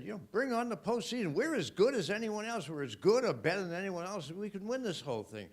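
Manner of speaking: high, fast excited declaration; rally the fans, sure we can win it all. Retold or imitated. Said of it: "And I said".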